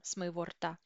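usual speaking voice

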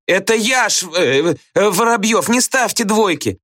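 high, stumbling schoolboy plea; clowning plea. A narrator's reading. Pupil begs the teacher for no bad mark, high and faltering. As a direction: stumble over the name in a high voice.

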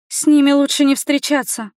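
ordinary delivery, nothing marked

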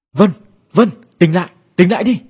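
high, loud calls to wake someone; urgent calling. A narrator's reading. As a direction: call out urgently to rouse a sleeper. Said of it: "Tiếng gọi của anh trai tôi". (gọi lớn, giục tỉnh dậy)

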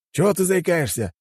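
high-pitched, irked question; displeased grumble. A narrator's reading. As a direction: grumble at the stammering assistant. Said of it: "недовольно буркнул председатель". (недовольно, высоким голосом)

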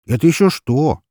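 playfully overdone exclamation; theatrical exclamation. A narrator's reading. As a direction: announce it like a stage act. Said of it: "театрально воскликнул я".

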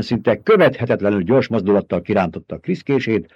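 ordinary delivery, nothing marked

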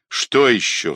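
ordinary delivery, nothing marked

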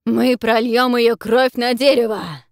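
loud, slow, triumphant roar; thundering excited hunter. Acted. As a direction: roar it with glee. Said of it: "восторженно гремит охотник".

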